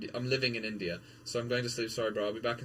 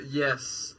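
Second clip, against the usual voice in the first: usual speaking voice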